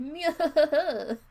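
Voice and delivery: high, loud real laugh; loud burst of laughter. Real, not acted. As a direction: crack up out loud.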